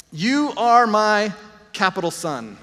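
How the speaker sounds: slow, deliberate "You are my capital son"; solemn proclamation, each part given its weight; weighty emphasis. Retold or imitated. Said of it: "he was thinking lowercase son".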